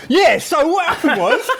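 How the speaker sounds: words squeezed through high laughter; laughing. Real, not acted. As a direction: giggle your way into the story. Laughing while restarting story.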